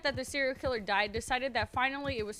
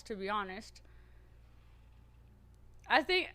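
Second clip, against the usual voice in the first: usual speaking voice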